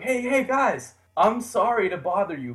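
high, eager, apologetic rush of words; eager nervous hello. Retold or imitated. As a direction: bound up to strangers, keen but polite. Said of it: "I ran up to 'em like".

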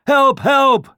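high, loud calls for help; crying out. A narrator's reading. Hares racing up to the deer, crying out. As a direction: cry for help in a high panic. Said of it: "cried the hares".

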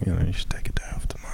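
soft fast whisper. Real, not acted. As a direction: hush it, quick and gentle. Gentle advice to a tired friend.

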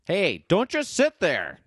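game show host. Retold or imitated. Quoting a nineties kids' game show, hammed-up host voice.

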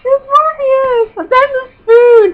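high, loud, stretched-out mock fan shriek; so excited. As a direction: re-enact star-struck blurting, hammed up. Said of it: "I was just so excited".

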